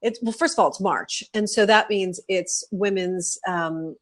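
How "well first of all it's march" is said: ordinary delivery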